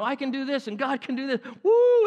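high-pitched hyped-up journal entry; excited. Retold or imitated. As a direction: gush it, bursting into a whoop. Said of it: "you get excited".

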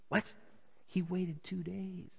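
soft, slow, shocked question; hushed disbelief. Acted. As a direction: echo the rumor in disbelief. Second onlooker reacts to the rumor.

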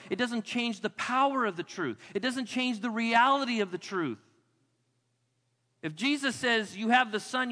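high, loud, driving sermon climax; rising fervent pulpit voice; full-force preaching. Acted. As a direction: preach it hard, pushing the congregation.

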